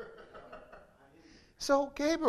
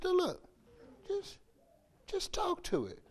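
higher, soft patient instruction; gentle God voice; 8 semitones higher, 10 dB quieter than usual. Retold or imitated. God coaching Moses, soft and high. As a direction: coax him gently to just talk. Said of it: "God says".

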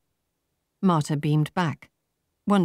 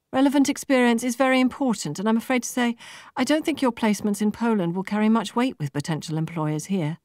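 higher, bouncy professional cheer; a light voicing of an upbeat recruiter; perky young businesswoman; 7 semitones higher than usual. A narrator's reading. Laura telling Marta her scores, perky and high.

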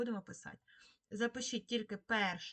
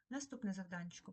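usual speaking voice

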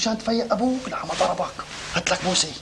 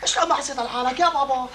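ordinary talk, nothing marked

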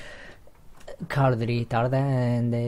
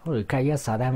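usual speaking voice

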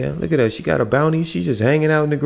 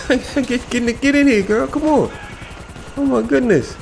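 frantic urging; 13 semitones higher than usual. Real, not acted. Panicked escape, shots fired.

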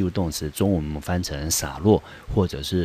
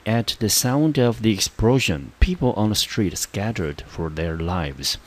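slow, careful example sentence read aloud; slow model reading; 3 semitones higher than usual. Shown as a demonstration. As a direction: read it out clearly for learners. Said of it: "第二个例句".

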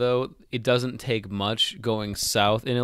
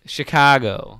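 Chicago accent; 8 dB louder, slower than usual. Shown as a demonstration. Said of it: "now say".

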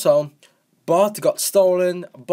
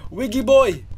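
high, playful hail; playful call; 7 semitones higher than usual. Pretend. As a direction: call out to a pal, pitched up. Calling out to game character.

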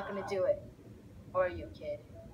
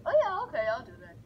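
mock eager reply; 10 semitones higher, 3 dB louder, faster than usual. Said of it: "he's like".

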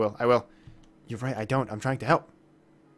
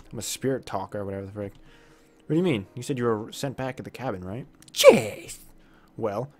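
ordinary talk

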